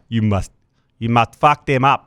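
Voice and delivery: put-on South African accent that sounds like the Terminator, slow; a heavy Schwarzenegger-tinged South African order. Host trying a South African accent, co-host calls it Terminator. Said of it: "very Terminator".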